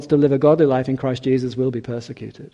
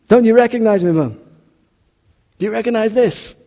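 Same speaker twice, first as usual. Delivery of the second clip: high, loud, urgent appeal; pleading son; 7 semitones higher, 5 dB louder than usual. Acted. Son on the doorstep begs his mother to recognize him, pleading.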